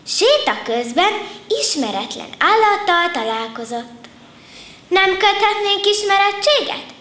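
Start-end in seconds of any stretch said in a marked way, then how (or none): 4.9-6.9 eager lion cub. A narrator's reading. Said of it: "ajánlkozott az oroszlánkölyök".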